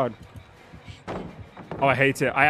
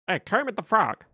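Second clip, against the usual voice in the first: higher puppet frog voice; Kermit the Frog; 3 semitones higher than usual. Acted. Streamer testing the voice after hearing a clip, Kermit impression. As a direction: try a cartoon frog greeting. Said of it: "what's Kermit sound like?".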